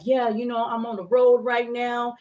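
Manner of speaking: loud, slow, assertive caller; imitating aggressive man. Truck driver talking to his date, loud mock-assertive voice. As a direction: push it out like a dominant guy. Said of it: "his energy was aggressive. It was assertive".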